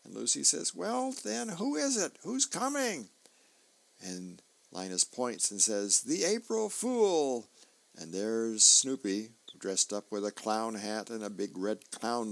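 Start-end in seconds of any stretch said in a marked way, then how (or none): 0.8-3.1 put-on a much higher, demanding little girl; Lucy pressing Linus in a high girl's voice. 6.0-7.5 put-on much higher and slow, a little boy's big reveal; slow, high kid reveal. Linus reveals the visitor.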